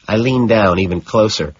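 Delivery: ordinary delivery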